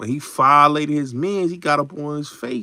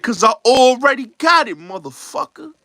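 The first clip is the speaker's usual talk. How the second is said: higher and louder, taunting; cocky character quote; 9 semitones higher, 6 dB louder than usual. Retold or imitated. Retelling Pac in Juice, high loud taunt.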